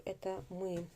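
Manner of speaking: normal talking voice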